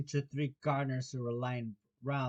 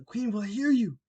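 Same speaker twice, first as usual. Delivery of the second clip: high, louder, alarmed warning; frightened; 7 semitones higher, 8 dB louder than usual. Acted. Rabbit warning laughing Alice, frightened. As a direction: warn urgently of being overheard. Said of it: "whispered in a frightened tone".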